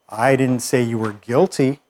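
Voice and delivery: slow, with the weight on "guilty" at the end; stress on GUILTY. Shown as a demonstration. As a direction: save the emphasis for "guilty". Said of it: "emphasize different words".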